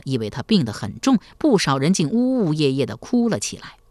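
ordinary delivery, nothing marked

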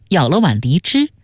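ordinary delivery, nothing marked

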